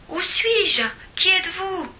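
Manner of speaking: high, frightened questions; a high anxious voice; bewildered. A narrator's reading. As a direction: ask nervously into the dark. Lost in the dark.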